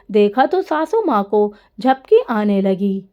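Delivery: ordinary delivery, nothing marked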